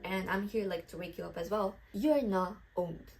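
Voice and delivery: ordinary talk, nothing marked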